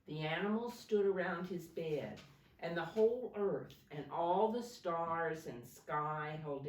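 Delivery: put-on dramatic read-aloud for kids; storybook reading. A narrator's reading. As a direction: read the Christmas story to little ones with energy. Reading picture book to children.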